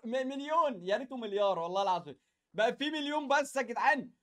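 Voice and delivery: normal talking voice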